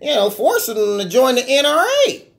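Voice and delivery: imitating a whiny pundit; lightly whiny, nasal-free mock whine. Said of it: "he got the whiny voice".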